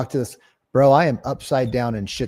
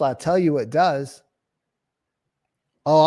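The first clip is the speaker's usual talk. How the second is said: ordinary talk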